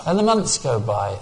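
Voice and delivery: normal talking voice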